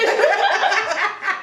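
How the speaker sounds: high burst of laughter; loud laugh. Real, not acted. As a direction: crack up out loud. Friend laughs at the accent.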